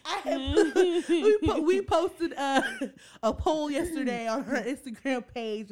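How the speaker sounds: talking through laughter; laughing. Real, not acted. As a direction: giggle while you speak.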